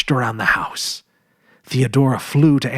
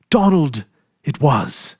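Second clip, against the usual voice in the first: plain reading voice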